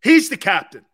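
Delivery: proud, loud declaration; loud hype. Real, not acted. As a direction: shout it out like a fan. Hyping the captain.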